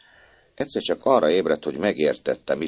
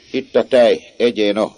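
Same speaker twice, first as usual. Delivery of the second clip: brusque offering; 5 semitones higher, 7 dB louder than usual. A narrator's reading. Maid pushes milk.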